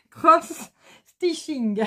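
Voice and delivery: slow, laughing mock English accent on "Cross Stitching"; hammed-up English accent, laughing. Shown as a demonstration. Reading a magazine title to viewers, in a laughing, hammed-up English accent. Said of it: "un accent anglais".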